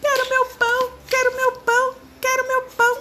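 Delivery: very high, loud whining monkey cry; hammed-up squeaky monkey wail. A narrator's reading. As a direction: sob like a small monkey who lost everything. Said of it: "gemendo e chorando".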